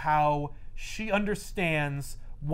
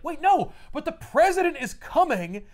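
high, loud, insistent protest; frantic Talbot plea; 7 semitones higher, 3 dB louder than usual. Retold or imitated. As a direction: plead like an overexcited fanboy, pitched up. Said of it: "Talbot was involved in some shit".

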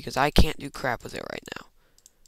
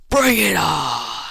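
loud, drawn-out yell; mock-heroic drawn-out roar; battle cry; 4 semitones higher, 9 dB louder, much slower than usual. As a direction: holler it long and high. Hyped battle cry in game.

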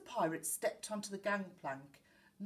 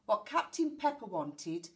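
ordinary delivery

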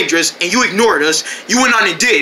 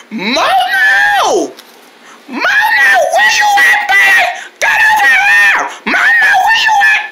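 high-pitched drawn-out yell; shrieked search call; 3 dB louder, slower than usual. Acted. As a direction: holler for someone out of sight. Calling out for missing Momo.

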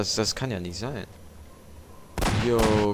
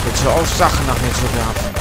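loud angry yell at the enemy; angry shout; 3 semitones higher, 10 dB louder than usual. Real, not acted. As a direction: yell at him to stop throwing. Enemy throws things at him.

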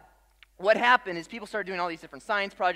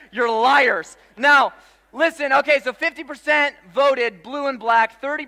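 loud, high, rallying the room; full-force preaching; 8 semitones higher, 12 dB louder than usual. Acted. Pastor polling the congregation, preaching at full volume. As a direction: preach it to the back row.

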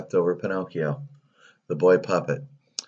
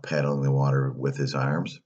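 ordinary delivery

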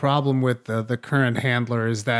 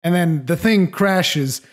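ordinary talk

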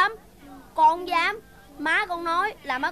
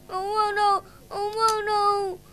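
high, tearful repeated refusal; child refusing porridge in tears; crying refusal; 4 semitones higher than usual. Acted. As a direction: sob out the refusal with a pout.

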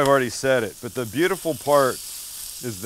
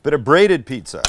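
excited reveal; 3 semitones higher, 7 dB louder than usual. Real, not acted. Big reveal of the dish.

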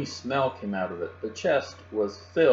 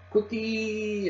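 very high parrot squawk; piercing, drawn-out bird cry; parrot scream; 13 semitones higher than usual. A narrator's reading. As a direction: screech it like a parrot, last vowel held. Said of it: "it screamed".

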